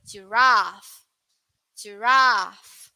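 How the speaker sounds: "giraffe" long and open; British pronunciation. Shown as a demonstration. Teacher repeating the UK "giraffe" for students. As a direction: UK "giraffe", held "raff". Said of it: "For U K".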